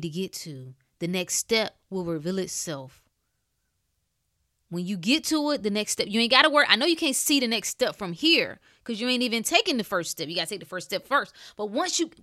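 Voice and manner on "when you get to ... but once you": loud, fast preacher build; preaching at full force. Acted. Urging listeners to take the first step, loud fast preaching. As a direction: drive it home to the crowd.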